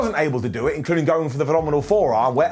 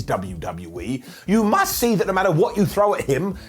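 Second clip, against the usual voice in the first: normal talking voice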